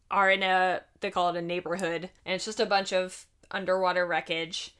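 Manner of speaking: ordinary talk, nothing marked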